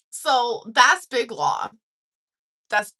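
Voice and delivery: usual speaking voice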